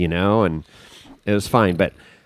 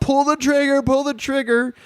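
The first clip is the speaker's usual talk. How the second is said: urgent call pitched way up; imitated yell. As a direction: imitate a bystander egging someone on. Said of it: "somebody yells out".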